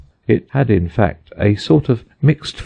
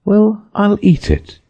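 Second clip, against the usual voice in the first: put-on high, slow, resolved girl's voice; decide boldly; Alice deciding; 14 semitones higher than usual. A narrator's reading. Alice choosing to eat the cake, high and deliberate.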